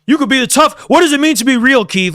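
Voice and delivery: high, teasing challenge, daring him to answer; mocking taunt. Real, not acted. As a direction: goad him like a schoolyard taunt.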